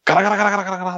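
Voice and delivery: fast, repeated rattling noise; imitated vacuum rattle. Telling co-host how coins got sucked up, fast imitated rattle. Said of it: "って吸ってたんですけど".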